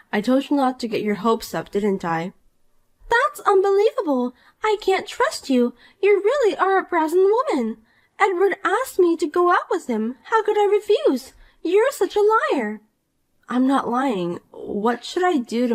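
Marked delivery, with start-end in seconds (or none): 3.1-12.8 put-on a higher, bratty young woman in disbelief; Poppy, indignant. Rival wife-hunter scoffs at the married woman, higher and bratty. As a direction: play the spoiled rival who refuses to believe it.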